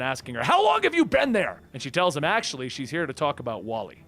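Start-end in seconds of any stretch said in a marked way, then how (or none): 0.4-1.6 high, sharp demand; startled shout. A narrator's reading. Damian startled by Raven, shouting. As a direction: whip round and call out. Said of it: "spins around shouting".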